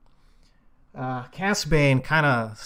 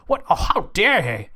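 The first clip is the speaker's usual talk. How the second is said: mock-offended sputter, a bit louder; sputtering indignation; 8 semitones higher, 3 dB louder than usual. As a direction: huff and sputter in disbelief. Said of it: "sputtering in the background".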